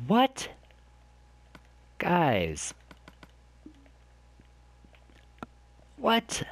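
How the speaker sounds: sharp high cry of shock; suddenly astonished; startled surprise. Real, not acted. Finds something surprising.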